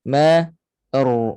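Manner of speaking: Ma light and thin, ru heavy and full; Ma said thin, then ru said thick. Shown as a demonstration. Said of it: "Ma nipis, ra tebal".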